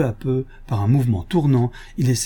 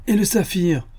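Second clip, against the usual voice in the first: curt order; 10 semitones higher than usual. A narrator's reading. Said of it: "ordonna Ganimard".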